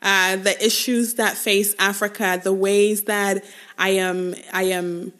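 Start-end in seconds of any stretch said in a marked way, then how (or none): none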